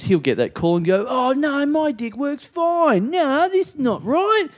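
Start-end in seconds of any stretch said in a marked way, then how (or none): 1.1-4.5 high, slow, hammy denial; overacted wounded pride, dragged out; bad acting. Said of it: "the worst acting I've ever seen you do".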